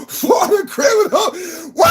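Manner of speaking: high laughing cry; laughing shout. Real, not acted. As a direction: burst out laughing as you shout it.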